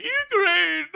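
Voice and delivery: high, loud, hammed-up sobbing wail breaking into laughter; mock crying Kimmel. Mocking the crying talk show host, hammed-up wail. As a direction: wail it like a blubbering host, then crack up. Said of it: "he starts crying when he gets to Ukraine".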